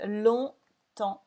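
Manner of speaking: "longtemps" said slowly with no G, P or S sounded; silent letters. Shown as a demonstration. Teacher slowly modelling "longtemps" with silent letters. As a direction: let the final letters of "longtemps" stay silent. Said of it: "We don't say the G and we don't say the P".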